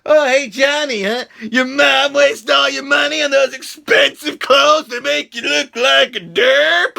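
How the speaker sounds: high, loud mocking schoolyard taunt. Showing how to mock the popular kid, high taunting jeer. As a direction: jeer at him like a bully. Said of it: "You just be like".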